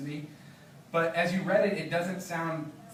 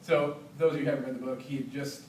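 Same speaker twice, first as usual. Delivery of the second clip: ordinary talk, nothing marked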